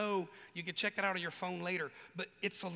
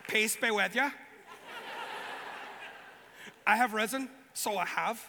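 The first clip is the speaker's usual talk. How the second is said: put-on Northern Irish accent, louder, jokey; Northern Irish Jesus; 3 dB louder than usual. Joking Jesus came from Fermanagh, Northern Irish accent. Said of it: "Just imagine a guy".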